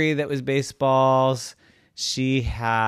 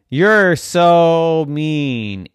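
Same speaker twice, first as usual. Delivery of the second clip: slow, loud, higher reproach; mad kids scolding; 5 semitones higher, 9 dB louder, much slower than usual. A narrator's reading. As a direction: drag it out, loudly scolding.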